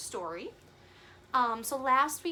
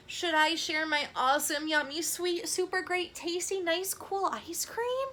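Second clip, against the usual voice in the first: much higher, louder thrilled squeal; Gerald's thrilled squeal; excited; 6 semitones higher, 5 dB louder than usual. Acted. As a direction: burst with delight over ice cream. Gerald torn over sharing.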